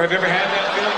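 usual speaking voice

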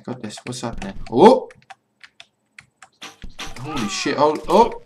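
normal talking voice

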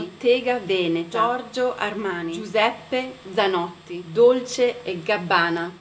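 native Italian pronunciation of each name; careful Italian accent on fashion names. Shown as a demonstration. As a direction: roll through the names as an Italian speaker would. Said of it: "this is how I say, um, the words".

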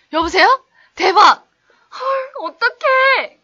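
high, loud hammed-up shock; shocked phone call. Teacher phoning a friend in a skit, loud high shock. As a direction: act out a flustered call to a friend. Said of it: "이 상황극 이렇게 전화해가지고 친구랑 통화하는 거죠".

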